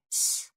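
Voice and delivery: soft hissed shush; sharp shush. A narrator's reading. Halted on the path listening, a hushed shush. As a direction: hush them with a long quiet "sss". Raf signals silence mid-chase.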